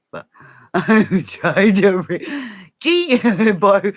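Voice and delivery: high, giggly, halting speech; real laughter breaking up the words; laughing. Real, not acted. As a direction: try to talk through giggles. Laughing while trying to remember.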